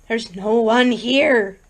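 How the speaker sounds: slow, strained, every word leaned on; queer strained emphasis. A narrator's reading. Vicky to Bert in the empty town, slow strained emphasis. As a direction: press each word out, uneasy. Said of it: "with a queer, strained emphasis".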